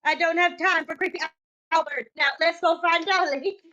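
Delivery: usual speaking voice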